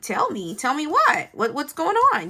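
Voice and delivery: high, loud pressing questions; urgent demanding. Retold or imitated. Mother to middle child coming to tell her, acted urgent demand. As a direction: act out a parent demanding answers.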